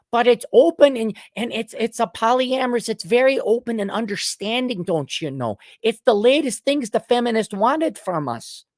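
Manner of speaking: high, earnest put-on Minnesota accent. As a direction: explain it as an agreeable Minnesotan. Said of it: "This is the Minnesota accent".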